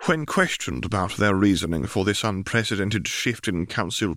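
crisp broadcast bulletin; news read. Acted. Piltover Star reporter announcing the edict to listeners, news read. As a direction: read it like the morning news on air.

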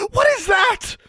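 very high, startled shriek. A narrator's reading. Roommate spots the caged snake on waking, shrieking. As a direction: cry out in a high-pitched shriek. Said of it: "to Anna shrieking".

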